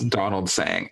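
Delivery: usual speaking voice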